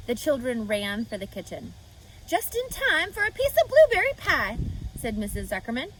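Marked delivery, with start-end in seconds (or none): 2.3-4.6 high, bright motherly farm wife; higher and louder, a cheerful farm wife. Acted. Farm wife greets the children.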